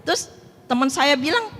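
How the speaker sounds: normal talking voice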